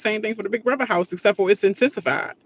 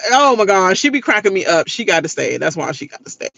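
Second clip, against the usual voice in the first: normal talking voice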